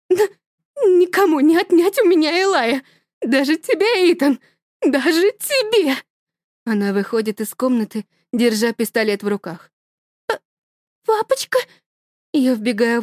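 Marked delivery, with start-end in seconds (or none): 0.1-6.0 high, loud, crying defiance; mother screaming at husband; hysterical mother. Acted. 10.3-11.6 put-on much higher, small child's call; little girl calling daddy; frightened child.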